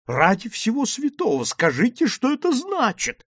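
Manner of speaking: very high, exasperated exclamation; high-pitched bewildered outcry; crying out. Acted. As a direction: demand an explanation in alarm. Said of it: "вскричал Ван Тромп".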